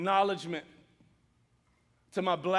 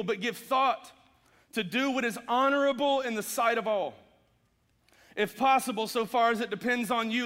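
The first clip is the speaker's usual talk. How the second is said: raised, ringing scripture reading to the church; 5 semitones higher, 4 dB louder than usual. Acted. Preacher reads Romans to his congregation, in a raised proclaiming voice. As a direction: proclaim each verse to the room.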